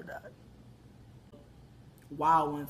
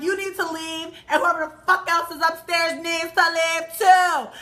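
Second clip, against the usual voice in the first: high mock yell, building louder; angry ordering out; 8 semitones higher, 7 dB louder than usual. Woman throws everyone out of upstairs, angry ordering. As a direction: imitate a furious eviction.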